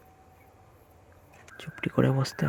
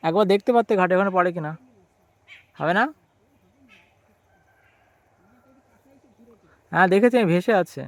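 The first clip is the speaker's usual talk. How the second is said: calling out; 6 semitones higher, 9 dB louder than usual. Real, not acted. Calling to aunt across the pond.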